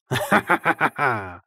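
loud, throaty clown cackle; staccato throaty laughter. A narrator's reading. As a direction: laugh from the throat in quick bursts. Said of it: "Smál se hrdelním smíchem".

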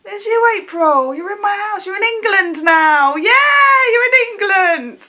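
high, loud giddy shouting; crazy excitement. Real, not acted. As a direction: cheer at them like a thrilled lunatic. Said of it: "I sound crazy".

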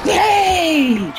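high, loud celebratory yell; excited shout. Real, not acted. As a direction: cheer out loud. Celebrating a pass.

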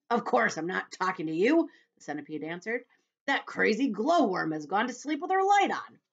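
lightly voiced cross centipede; grumpy centipede. Centipede voice read aloud.

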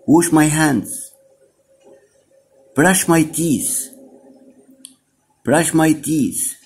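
put-on high, bright model for the children; teacher drill voice. Shown as a demonstration. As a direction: sing it out for little learners. Teacher drills phrases for kids. (صوت المعلمة للأطفال)

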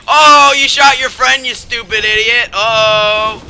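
loud, high crowing taunt; gleeful jeering. Real, not acted. Gamer taunting an enemy who shot his friend, gleeful jeering. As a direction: jeer at the foe's blunder.

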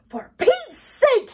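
high, slow, exasperated holler. A narrator's reading. Boy whose hair is pulled, hollering. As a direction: holler it in outrage, drawn out. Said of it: "he hollered".

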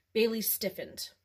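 ordinary delivery, nothing marked

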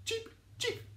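high squeaky chick cheeps; bird cheeps. Acted. Teacher voicing baby birds for the children. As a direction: peep it like a tiny bird. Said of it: "Two little birds say".

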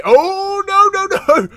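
high, loud panicked no; high-pitched alarmed protest; dismayed. Real, not acted. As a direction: cry out as allies attack. His men turn on him.

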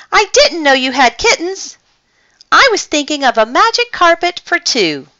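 high-pitched, taken aback; surprised outcry. A narrator's reading. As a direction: protest in surprise, voice jumping up. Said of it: "cried O'Malley".

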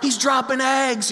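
mock playground rallying cry; imitating excited kids. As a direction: call out like a small child spotting treasure. Small kids calling out at egg hunt.

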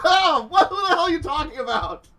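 high, loud frantic protest; panicked. Acted. Dawn startled by the spooky woman, panicked. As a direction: blurt it out in a panic.

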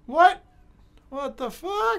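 high, loud startled what; high yelp of disbelief; shocked disbelief. Real, not acted. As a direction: blurt it out in sudden shock. Shocked at race result.

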